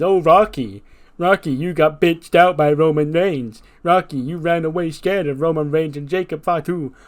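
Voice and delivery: loud, high, sneering mock of the critics; mocking quote. The Rock mocking his doubters, loud sneering. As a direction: mimic the taunts with scorn. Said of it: "all I've heard since Saturday is".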